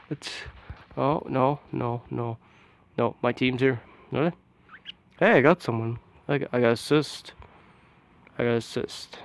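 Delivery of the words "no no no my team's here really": ordinary talk, nothing marked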